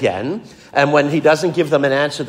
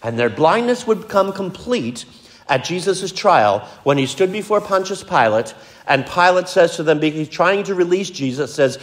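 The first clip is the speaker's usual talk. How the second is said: normal talking voice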